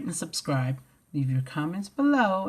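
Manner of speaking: usual speaking voice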